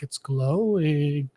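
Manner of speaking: slow, low, hushed and spooked; eerie hushed awe. Retold or imitated. Adrian staring at the glowing monolith, slow and hushed. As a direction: breathe it out like seeing something uncanny.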